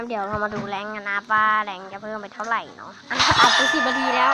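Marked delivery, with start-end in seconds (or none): 3.4-4.3 excited shout. Real, not acted. Reacting to game result.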